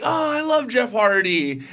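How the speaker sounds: high, drawn-out adoring sigh of a fan; gushing fan. Acted. Voicing wrestling fans on a high before the letdown, gushing. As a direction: gush slowly up high, imitating a fan.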